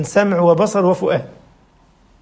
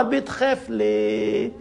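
slow, much softer بتخاف ليه; hushed question; 8 dB quieter, much slower than usual. Real, not acted. The preacher quietly confronting the atheist, slow and soft. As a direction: drop to a slow near-hush. Punchline after build-up.

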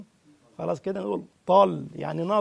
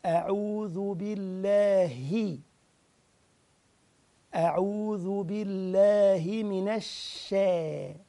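slow, measured tajweed reading; slow recitation; much slower than usual. Shown as a demonstration. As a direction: recite each letter deliberately. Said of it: "حرف مرقق قوي".

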